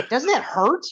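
a touch of astonished disbelief; puzzled surprise. Retold or imitated. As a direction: ask as if you can't believe what you just saw. Retelling seeing a friend hit himself.

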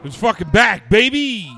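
loud, high excited announcement; real hype yell; hype shout. Real, not acted. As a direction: shout it like the show's return. Hype show return.

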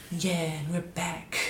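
slow, soft laid-back radio host. Retold or imitated. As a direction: mellow late-night DJ intro. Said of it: "You be using your radio voice".

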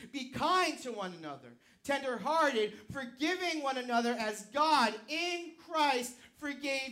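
loud, high, driving sermon delivery, slowing to hammer key lines; preaching at full force. Acted. Pastor urging the congregation to forgive, preaching at full force. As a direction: preach it to the back row with fire.

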